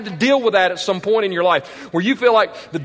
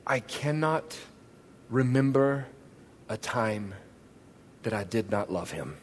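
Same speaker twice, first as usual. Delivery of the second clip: soft quoted testimony; 11 semitones lower, 13 dB quieter than usual. Retold or imitated. Quoting a woman's testimony softly.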